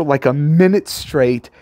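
ordinary delivery

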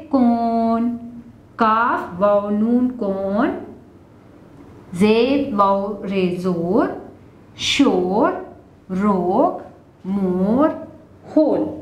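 deliberate classroom spelling; slow, careful spelling aloud; slow spelling demo. Shown as a demonstration. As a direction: spell it out slowly, letter by letter. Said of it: "वाओ मजहूल कहलाती है".

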